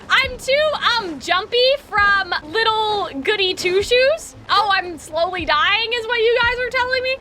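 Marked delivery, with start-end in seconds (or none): none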